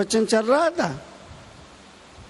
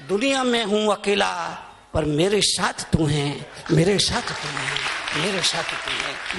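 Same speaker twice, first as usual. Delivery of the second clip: tender prayer; 5 semitones lower than usual. Real, not acted. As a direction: confide it humbly to God. Devotional line, low first. (धीमे, गहरे स्वर में भक्ति)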